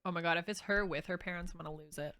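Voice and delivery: normal talking voice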